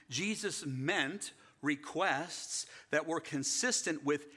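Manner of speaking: high, loud preaching at full force; preacher warning against false teaching, loud; fiery preaching. Acted. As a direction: drive the point home from the pulpit.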